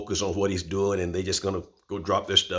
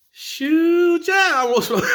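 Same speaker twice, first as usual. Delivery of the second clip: high, loud, laughing yes to more food; eager shout; 10 dB louder, slower than usual. Retold or imitated. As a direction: shout it out laughing, hungry and happy. Eager answer to aunt's offer.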